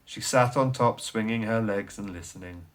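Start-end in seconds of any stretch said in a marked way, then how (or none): none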